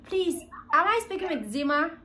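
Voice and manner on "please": ordinary talk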